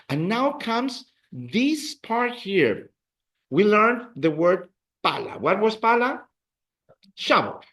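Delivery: normal talking voice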